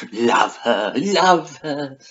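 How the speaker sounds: drawn-out, rising gush; gushing adoration. Pretend. Vlogger fawning over a book character, gushing.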